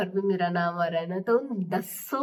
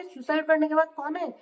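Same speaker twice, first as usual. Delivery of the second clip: normal talking voice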